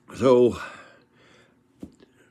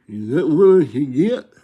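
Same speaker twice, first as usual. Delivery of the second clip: imitating Lane; 4 semitones higher, 8 dB louder than usual. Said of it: "and Lane goes".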